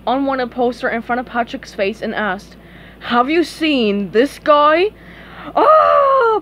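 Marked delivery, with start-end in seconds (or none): 3.0-4.9 slow, loud, raised-pitch interrogation; questioning cop. Acted. Officer shows Patrick the wanted poster, questioning. 5.5-6.4 very high, loud shriek; terrified scream. Acted. Patrick sees the maniac's picture, screaming. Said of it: "Patrick screamed".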